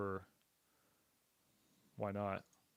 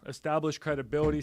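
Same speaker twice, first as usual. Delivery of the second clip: normal talking voice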